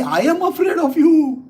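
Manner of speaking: high, loud mock terror; feigned fear. Lord mocking the great demon, feigned fear. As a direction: wail it as a teasing show of fright. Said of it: "It's a feigned way".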